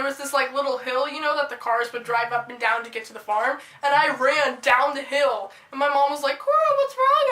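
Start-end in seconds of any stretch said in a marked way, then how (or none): 6.4-7.3 high-pitched mock mom calling out; exaggerated motherly alarm. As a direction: imitate a worried mom, pitched up. Mom calls to fleeing child.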